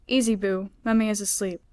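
usual speaking voice

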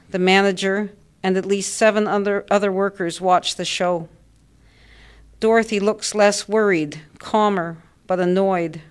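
ordinary delivery, nothing marked

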